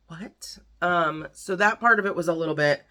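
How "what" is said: whispered, questioning disbelief; baffled whisper. Real, not acted. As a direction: drop to a tiny whisper, puzzled. Baffled aside about the book.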